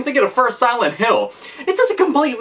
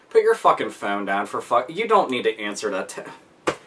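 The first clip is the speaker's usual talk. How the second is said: deep, softer monster grumble under its breath; a fed-up monster muttering at the players; a monster, deeper, telling them to put the phone down; grumbling monster; 10 semitones lower, 5 dB quieter than usual. Retold or imitated. Monster scolding chatty players.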